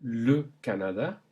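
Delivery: le Canada in French; French plain E. Shown as a demonstration. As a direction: model the le. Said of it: "Repeat them one more time".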